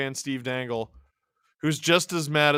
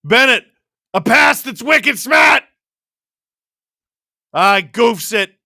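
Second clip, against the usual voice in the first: put-on loud, high Boston-accented play call; 7 semitones higher, 11 dB louder than usual. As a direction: shout it like a Boston commentator, drawn out. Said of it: "call one shift with a Boston accent".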